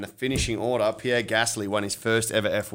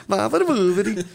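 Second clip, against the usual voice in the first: high squeaky voice; 12 semitones higher, 6 dB louder than usual. Retold or imitated.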